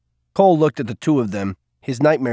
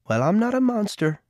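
smiling; 10 semitones higher than usual. Acted. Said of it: "she smiled".

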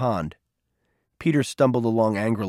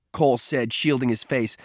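ordinary delivery

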